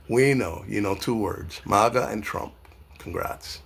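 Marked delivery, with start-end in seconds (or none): 3.0-3.6 whispered sarcastic congrats, then a breathy laugh; mock congrats. Real, not acted. Mocking a Trump fan's vocabulary, whispered scorn.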